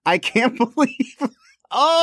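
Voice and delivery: high, exasperated protest, stretching into an incredulous cry; exasperated disbelief. Real, not acted. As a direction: protest in real disbelief, pitch climbing. Host protests co-host's verdict on film.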